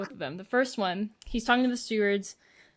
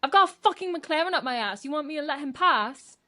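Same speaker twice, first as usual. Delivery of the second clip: higher-pitched mimicked intonation; put-on voice, pitched up in mock protest; George Russell; 4 semitones higher than usual. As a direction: imitate an indignant driver on the radio. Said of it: "redo that in his voice, like, in his intonation".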